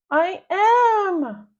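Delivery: high, loud wail; whiny cry. Acted. Daughter to mom while dragged, high wail. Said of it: "I cry".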